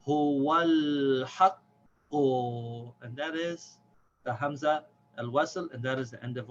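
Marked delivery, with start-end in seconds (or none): 0.1-2.9 "Huwal haqqu" very slow and joined, l held long; drawn-out smooth recitation of "Huwal haqqu"; smooth reading. Shown as a demonstration. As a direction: read "Huwal haqqu" in one flowing line. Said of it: "Let's read it smoothly now".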